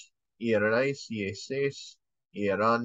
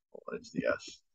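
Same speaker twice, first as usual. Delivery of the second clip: whisper; 10 semitones lower, 10 dB quieter than usual. Real, not acted. Aside while teacher conjugates.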